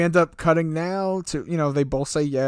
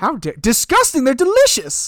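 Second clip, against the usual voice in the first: mock outrage; 8 semitones higher, 4 dB louder than usual. Real, not acted. Mock outrage at food insult.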